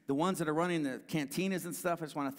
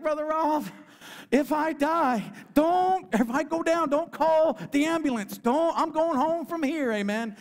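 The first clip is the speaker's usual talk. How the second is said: loud, much higher, caught up in the spirit; fervent shout; 12 semitones higher, 9 dB louder than usual. Retold or imitated. Buck Beavers telling Brother Rob at the revival, a high fervent shout. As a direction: shout it out like a man ready to meet God. Old veteran crying at revival.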